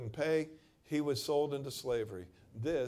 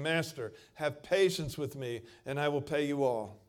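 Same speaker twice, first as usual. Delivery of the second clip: loud, raised-pitch begging; pleading servant; 3 semitones higher, 7 dB louder than usual. Acted. Servant begs his master for time, loud plea. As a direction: cry out for mercy to the master.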